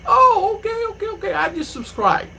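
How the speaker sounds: high, excited okays, then calmer; excited official. Retold or imitated. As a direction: mimic a delighted official. Official delighted after watching.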